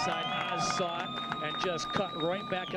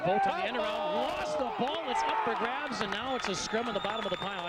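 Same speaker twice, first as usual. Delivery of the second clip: higher, urgent fumble call; excited call; 6 semitones higher than usual. Real, not acted. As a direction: call the loose ball live. Fumble on kick return.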